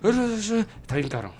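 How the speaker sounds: high drawn-out quote of Tito; quoting Tito. Retold or imitated. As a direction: voice a weary rower, high. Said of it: "él te va a decir".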